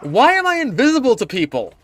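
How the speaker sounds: high, loud furious yelling; angry shout. Real, not acted. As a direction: yell at the useless team. Dying, teammates ignored him.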